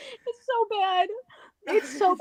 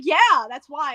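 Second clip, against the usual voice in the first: lower, lightly imitated "yeah, obviously"; matter-of-fact confirmation; 6 semitones lower, 3 dB louder than usual. He answers her late realization, mock matter-of-fact. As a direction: confirm it as old news, pitched down.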